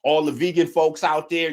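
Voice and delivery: ordinary delivery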